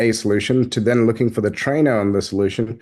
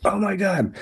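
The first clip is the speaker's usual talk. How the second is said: amazed; 8 semitones higher, 4 dB quieter than usual. Retold or imitated. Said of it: "And people were like".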